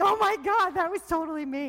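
shrieking recognition, hands-to-face moment; excited high exclamation; amazed realization. Retold or imitated. Retelling own realization.

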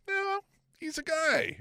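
high, slowed "No, he's a guy"; high dismissive correction. Retold or imitated. Podcaster retorting to fans, high and dismissive. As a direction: correct them with a lofty shrug. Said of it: "And we went".

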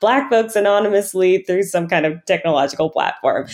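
thinking out loud about a big scheme, getting carried away; excited brainstorm. Retold or imitated. Dreaming aloud to the podcast hosts about moving wealth, excited. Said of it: "I'm like".

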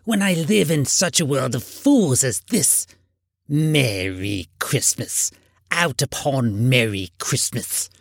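put-on indignant old miser's tirade; scornful, aggrieved Scrooge voice. A narrator's reading. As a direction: rant against the season as a crotchety old man. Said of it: "said Scrooge indignantly".

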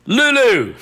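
high, loud showbiz cry of the name; announcing the star. Retold or imitated. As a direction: shout the star's name out to the audience. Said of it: "and I said".

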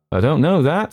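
sharp, high-pitched retort. A narrator's reading. Hurst answering the commercial's doubt, sharp. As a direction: come back sharply, pitch up. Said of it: "said Hurst sharply".

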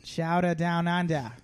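put-on slow mash-up of Australian and Boston accents; Australian Bostonian hybrid. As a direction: blend Aussie and Boston accents, stretched out. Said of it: "an Australian Bostonian hybrid".

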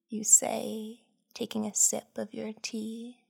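usual speaking voice

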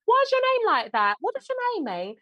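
high-pitched put-on British questioning; mock nosy Londoner. Londoner quizzing her about her name, high exaggerated mimicry. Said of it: "and someone's like".

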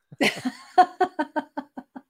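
high, loud burst of laughter; real loud, high-pitched laugh. Real, not acted. Both amused by taxi joke.

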